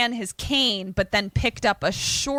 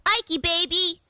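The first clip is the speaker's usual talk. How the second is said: high cooing pet voice; 8 semitones higher than usual. Real, not acted. Owner coos to her dog Ike in high baby talk.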